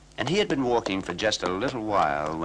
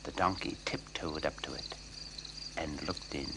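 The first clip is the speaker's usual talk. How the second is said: much softer, lower hushed narration; 6 semitones lower, 10 dB quieter than usual. A narrator's reading. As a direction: hush it like sneaking up. Narrating a sneaking donkey.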